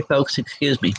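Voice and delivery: usual speaking voice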